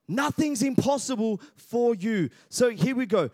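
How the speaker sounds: ordinary talk, nothing marked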